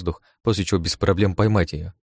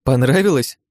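higher, louder mocking question; playful taunt, pitched up; teasing; 6 semitones higher, 5 dB louder than usual. A narrator's reading. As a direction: needle your shaken partner. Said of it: "не упускала случая её подтрунить".

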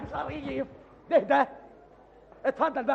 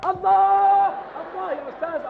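excited cheering; 9 semitones higher, 4 dB louder than usual. Acted. Listener cheers the song.

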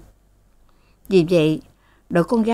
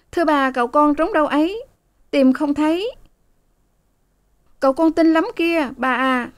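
high, chattering servant voices; young maids; 9 semitones higher than usual. A narrator's reading. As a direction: report brightly and high. Maids report to mistress. (giọng các cô hầu, cao)

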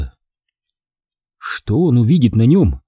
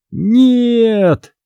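long, high cry of protest; 10 semitones higher than usual. A narrator's reading. Greg as the camera flash goes off, crying out. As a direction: cry out high, stretching the word. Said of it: "вскричал Грег".

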